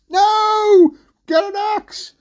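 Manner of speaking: high, loud yelled correction; exasperated shout. Acted. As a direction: yell it down to mum. Jack shouts the correction again.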